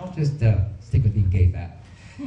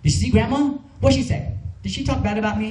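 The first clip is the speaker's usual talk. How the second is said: put-on high, jealous Vietnamese-accented mother; upset mother questioning her son about a dream, high and accented; imitating upset mom; 8 semitones higher than usual. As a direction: do his mother, hurt and suspicious, pitched up. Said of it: "my mother said to him".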